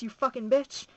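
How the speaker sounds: loud, forceful insult from the book; raw angry abuse. A narrator's reading. Woman berating the narrator in a novel, loud and raw. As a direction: spit out the insult, loud and hard. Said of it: "She was getting pretty raw by then".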